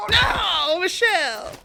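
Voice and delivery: very high, shouted no. Real, not acted. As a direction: yell out in dismay. Yelling at game failure.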